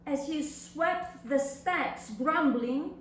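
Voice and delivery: ordinary talk, nothing marked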